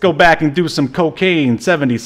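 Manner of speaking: high, loud, fast hammed-up director; imitating a director. Podcaster mimics a hurried director rushing the star, hammed-up. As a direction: play a pushy director barking orders. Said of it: "he's probably like".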